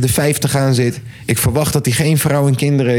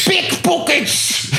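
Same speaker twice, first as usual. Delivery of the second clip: very high, long drawn-out mock yell; mock shouting; 14 semitones higher, much slower than usual. Thief spotter alerting the fair to thieves, drawn-out mock shouting. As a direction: stretch the warning into a squealed imitation cry. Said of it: "loopt hij te schreeuwen op die kermis".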